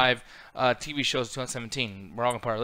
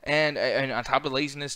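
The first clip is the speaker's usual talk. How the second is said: usual speaking voice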